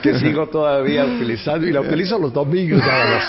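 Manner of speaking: light laughter running through the words; amused, chuckling aside; laughing while talking. Real, not acted. Laughs telling of the cologne gift.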